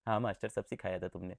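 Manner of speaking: low, quick meek student voice; mock student reply. Teacher voicing a pupil answering him, meek and quick. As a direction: mumble back like an obedient pupil.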